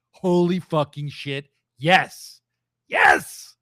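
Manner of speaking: building to a shouted yes; excited yell. Real, not acted. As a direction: fan losing it over a dream match. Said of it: "We need to see that".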